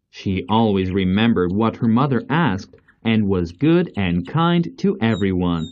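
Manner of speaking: ordinary delivery, nothing marked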